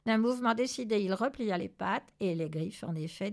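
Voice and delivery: usual speaking voice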